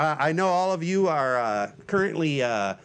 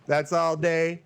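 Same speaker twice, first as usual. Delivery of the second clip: usual speaking voice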